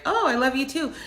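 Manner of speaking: high, delighted surprise; husband caught off guard, lightly imitated with surprised joy. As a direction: catch him off guard, thrilled. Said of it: "he was so surprised".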